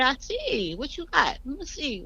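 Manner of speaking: high, sweet coaxing voice; retold gentle, indulgent curiosity; nice and calm. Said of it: "like, nice and calm".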